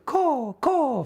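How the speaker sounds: high, loud crow caws; crow cawing. Acted. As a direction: caw out like a crow. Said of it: "They don't hear a crow".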